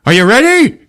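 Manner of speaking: high, loud mock challenge; loud build-up. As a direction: imitate a dad bursting to tell. Dad builds up to the revelation.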